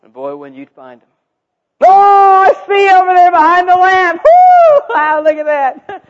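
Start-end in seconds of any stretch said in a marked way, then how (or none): 1.8-6.0 high, whooping mock-amazed shout; excited finder. Seeker finding children behind the lamp, excited shouting. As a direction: play up the discovery at full volume. Acting the finder's big discovery.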